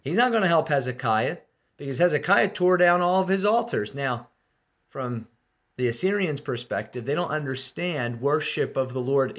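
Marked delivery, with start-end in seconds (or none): 0.0-3.9 higher, louder taunting speech; mocking enemy envoy. Assyrian chief taunting Jerusalem, loud and mocking. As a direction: jeer at them from outside the walls. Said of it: "He says".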